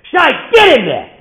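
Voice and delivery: put-on high, drawn-out kid's holler; mock-loving yell. Eleven-year-old captain sends Shai to bat, mock-loving yell. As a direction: bellow it as a boy's rough affection. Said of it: "how eleven-year-olds speak lovingly".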